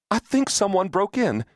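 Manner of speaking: high, alarmed report to a husband. A narrator's reading. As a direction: voice lifted high with worry. Wife tells husband of break-in.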